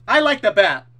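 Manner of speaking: loud, insistent defence of the bat; indignant shout. Real, not acted. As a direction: shout it back at chat. Arguing with chat over bats.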